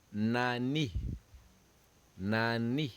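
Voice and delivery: "nani" said nah-nee; French word drill. Shown as a demonstration. Teacher models the French word for students. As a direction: model "nani" clearly for the class.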